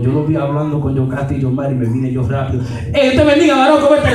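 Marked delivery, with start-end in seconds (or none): 2.9-4.1 high, loud-ish comic welcome; mock hearty greeting. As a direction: burst in on the rival with exaggerated cheer. Acts out rushing over to rival.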